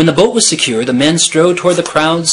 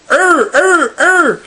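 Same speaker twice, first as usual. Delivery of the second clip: high, loud squawking bird call; bird cry; 4 dB louder, much slower than usual. A narrator's reading. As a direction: cry out like a flock of seabirds. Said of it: "a great thunder of".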